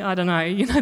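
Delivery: normal talking voice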